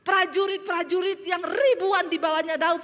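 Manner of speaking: full-force preaching. Real, not acted. Preacher asking where David's soldiers went, forceful high voice. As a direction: challenge the congregation, high and forceful.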